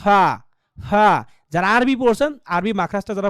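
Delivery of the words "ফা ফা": very slow ফা, ফা with air hissing between teeth and lip; true f sound. Shown as a demonstration. Teacher demonstrating English f to students. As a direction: drag out ফা as a long f. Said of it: "মাঝখান দিয়ে ভিতর থেকে হাওয়া বাইরে বের হয়ে যাবে".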